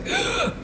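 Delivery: big mock gasp of onlookers; sharp high intake, imitating a crowd; imitated gasp. Imitating people spotting a supposed celebrity, shocked gasp. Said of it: "everyone was like".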